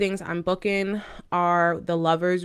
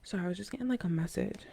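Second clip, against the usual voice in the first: quiet, inward murmur; soft and hushed; 10 dB quieter than usual. Real, not acted. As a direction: speak softly, as if sensing something. Receiving an intuitive message.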